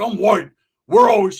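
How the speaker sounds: high, loud bragging as the rival; boastful shout. Acted. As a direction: holler it proudly, acted.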